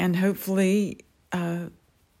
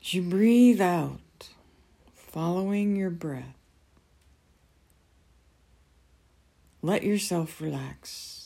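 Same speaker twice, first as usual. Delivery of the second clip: guided meditation. Acted. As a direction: ease them gently into calm.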